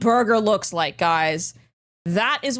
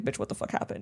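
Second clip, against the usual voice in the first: whispered disbelief; 7 semitones lower, 10 dB quieter than usual. Retold or imitated. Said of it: "you're like".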